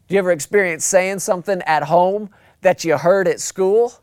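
ordinary talk, nothing marked

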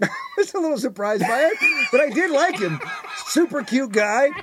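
laughing high through the words; giggling while admitting it. Real, not acted. Joking to his co-host about the fan, laughing.

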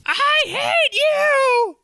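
drawn-out, high furious wail; high screaming wife. Acted.

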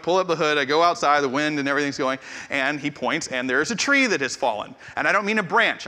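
ordinary talk

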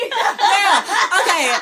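high laughing yeah; laughing hard. Real, not acted. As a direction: laugh through the words. Laughing at R. Kelly song.

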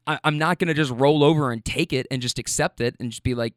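ordinary talk

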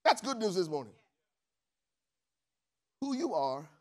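usual speaking voice

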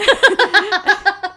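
high bright burst of laughter; loud laugh. Real, not acted. Laughing at her own story.

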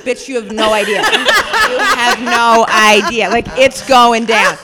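slow, loud, drawn-out threat; mock menace. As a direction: play it as a hammed-up threat. Mock-threatening retold reply.

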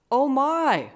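high, loud theatrical exclamation; big, swooping, hammed-up outburst; mock exclamation. A narrator's reading. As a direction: cry it out in mock alarm. Mock exclamation in story reading.

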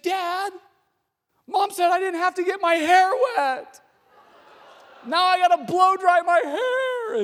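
high, loud mock tearful whine; wailing upset daughter. Daughter crying to dad over wet hair, mock wail. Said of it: "she starts crying instantly".

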